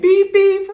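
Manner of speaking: loud, high-pitched beeps; imitated car horn. Host joking about the gifted car, imitated honking. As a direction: punch out two piercing honks.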